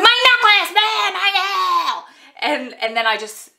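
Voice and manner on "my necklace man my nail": slow, high wail of annoyance; hangry shout. Retold or imitated. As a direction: yell it, fed up over the broken nail. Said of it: "And I was like".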